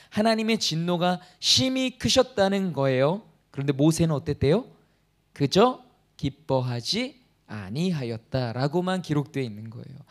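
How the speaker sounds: normal talking voice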